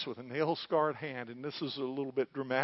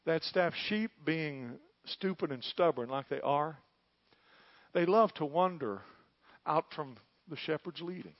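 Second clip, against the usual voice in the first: normal talking voice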